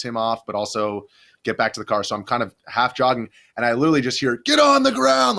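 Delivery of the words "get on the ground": imitating a cop's high, loud, drawn-out yell; screamed police order. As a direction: scream the command like an officer, stretched out. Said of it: "some other cop screaming".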